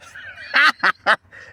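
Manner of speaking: loud, high burst of laughter; helpless real laugh, high and loud. Real, not acted. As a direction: crack up at your own story. Cracks up at own story.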